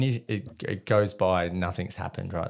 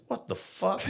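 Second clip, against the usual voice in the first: baffled; 11 semitones higher than usual. Retold or imitated. American soldier's angry reaction.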